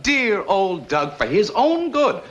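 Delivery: slow, singsong sarcasm, rising high; jealous mockery. Jealous coach throwing her words back, mocking. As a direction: mimic her devotion with drawn-out scorn.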